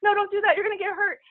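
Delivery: high, anxious mom warning; a high fretful mom saying no; mom's warning. Retold or imitated. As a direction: mimic a worried mother's high voice. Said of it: "until my mom's like".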